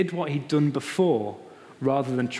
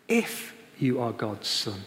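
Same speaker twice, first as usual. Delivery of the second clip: sly devil whisper-like; 3 semitones lower, slower than usual. Retold or imitated. Devil quoted, repeated softer.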